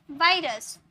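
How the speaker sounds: वायरस with a buzzing V, teeth on lip; V sound. Shown as a demonstration. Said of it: "वायरस यानी कि ऊपर वाले teeth से नीचे वाले लिप".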